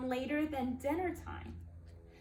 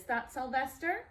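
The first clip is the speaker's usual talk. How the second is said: ordinary delivery, nothing marked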